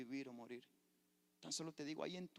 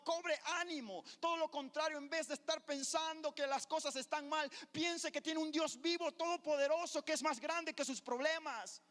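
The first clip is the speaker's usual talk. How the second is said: preaching at full force; 11 dB louder than usual. Acted. Pastor exhorting the church to praise God, full-force preaching. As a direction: shout the praise to the whole church. Climax of sermon.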